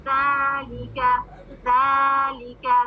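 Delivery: ज़ालिका, ज़ा held two counts, ली short; drawn-out ज़ा then short लि; slow ज़ालिका with long ज़ा. Shown as a demonstration. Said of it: "अलिफ मद के साथ है ना".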